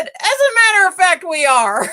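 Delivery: defiant tour guest blurting her answer, laughing; laughing retort. Retold or imitated. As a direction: fire the comeback through laughter. Retelling her snappy comeback.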